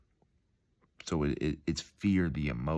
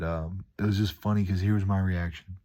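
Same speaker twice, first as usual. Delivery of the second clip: ordinary delivery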